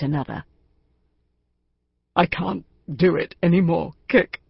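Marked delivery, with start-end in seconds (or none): 2.2-4.4 lightly voiced distressed older man; a hint of a broken chairman pleading; Benjamin. A narrator's reading. Benjamin on the phone to Kick, lightly voiced despair.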